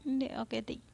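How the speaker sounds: ordinary delivery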